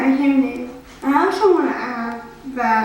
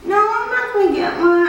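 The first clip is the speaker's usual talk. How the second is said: higher-pitched quoted refusal; retold refusal; 7 semitones higher than usual. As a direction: recall yourself refusing, pitch lifted. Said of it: "I refused to get one".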